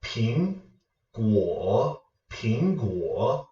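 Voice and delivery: "Ping" rising, "guo" dipping; second plus third tone. Shown as a demonstration. Teacher giving viewers a combination to practise, rising then dipping. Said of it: "a second tone with a third tone".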